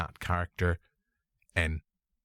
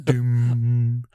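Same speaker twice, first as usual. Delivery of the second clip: mock booming voice; 8 dB louder than usual. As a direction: boom "Doom" like a film trailer voice. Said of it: "No, I can't do him".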